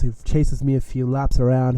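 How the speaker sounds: ordinary talk, nothing marked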